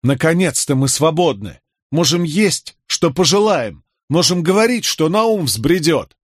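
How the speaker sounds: high, exultant proclamation of freedom; elated, impassioned. A narrator's reading. Rebel lad celebrates new freedom among comrades, elated. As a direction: proclaim it fervently, drawing out the ending. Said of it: "Голос его прервался от избытка чувств".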